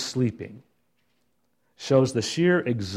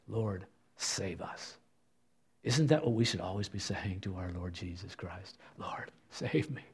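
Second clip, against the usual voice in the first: whispered plea; 5 semitones lower, 13 dB quieter than usual. Acted. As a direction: beg quietly for rescue. Pleading prayer to Jesus.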